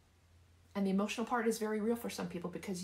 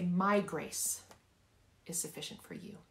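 emphatic "My", hushed ending; stress on MY. Shown as a demonstration. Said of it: "I want you to emphasize each word".

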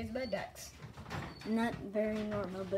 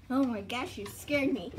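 ordinary talk, nothing marked

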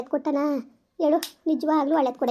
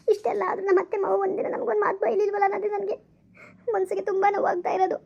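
higher and softer, still weeping; tearful; 5 semitones higher, 3 dB quieter than usual. Acted. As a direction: speak through lingering tears. Confessing to aunt, tearful. (ಅಳುತ್ತಾ ಎತ್ತರದ ಮೆಲು ಧ್ವನಿಯಲ್ಲಿ)

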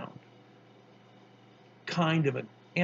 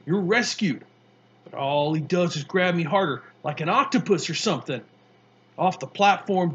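higher, louder, building amazement; rising in wonder; 5 semitones higher, 6 dB louder than usual. Acted. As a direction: let the wonder climb through the tale. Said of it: "his voice rising in wonder".

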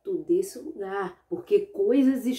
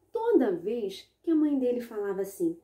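plain reading voice

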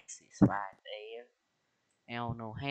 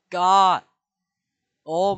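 shocked outburst; 7 semitones higher, 14 dB louder than usual. Real, not acted. Realizes tweezers/Twizzlers mistake.

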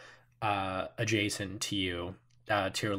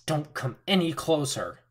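higher, tense warning; frightened woman with a knife; 5 semitones higher than usual. Acted. Woman behind the counter warns the intruders, tense. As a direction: back off, I'm armed. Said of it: "like a shiv at you and says".

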